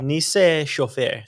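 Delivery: "Nice Chauffé" drawn out slowly; demonstrated pronunciation. Shown as a demonstration. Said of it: "how do you pronounce this".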